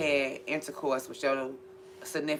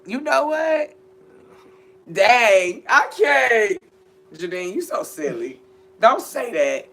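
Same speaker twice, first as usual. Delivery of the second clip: laughing disbelief; 6 semitones higher, 8 dB louder, much slower than usual. Real, not acted. Scandalised by a remark.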